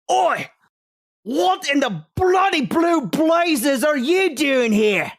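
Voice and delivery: loud, high Cockney bellow; Cockney shout. Acted. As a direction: bark it out like a rowdy Cockney drunk. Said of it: "fucking Cockney English twat".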